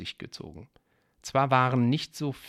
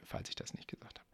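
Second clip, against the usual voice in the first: whisper; 16 dB quieter, faster than usual. Real, not acted. Quick aside to listener.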